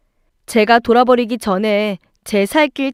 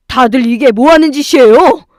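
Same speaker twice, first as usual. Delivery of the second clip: loud, indignant demand; booming shout; 3 semitones higher, 9 dB louder than usual. A narrator's reading. Husband bursting in on his family, booming shout. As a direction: break up the fight with a shout. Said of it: "우렁찬 소리가 들렸죠".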